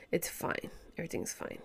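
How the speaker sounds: barely voiced, soft reassurance; whispered self-reassurance. Real, not acted. Telling herself everything is fine, in a whisper. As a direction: breathe it out to calm yourself. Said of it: "I have to tell myself that".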